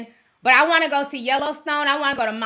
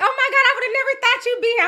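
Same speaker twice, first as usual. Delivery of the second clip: higher, gushing fan voice; excited fan; 12 semitones higher than usual. Retold or imitated. Fan at the club, gushing at her. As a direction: gush like a starstruck fan. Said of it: "She was like".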